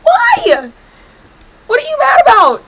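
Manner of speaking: high squealing protest; indignant shriek. Real, not acted. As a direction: yelp it out, outraged. Game reacts, she protests.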